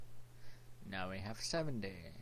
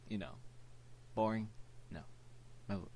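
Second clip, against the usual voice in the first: ordinary delivery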